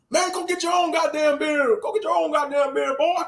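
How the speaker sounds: high-pitched cartoon hillbilly hollering; hammed-up hillbillies. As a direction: play two riled-up hillbilly buddies egging each other on. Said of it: "two hillbillies".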